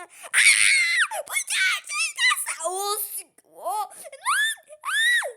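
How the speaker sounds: piercing high screams of shock; terrified screaming. Real, not acted. Wife screaming as the mouse turns out real.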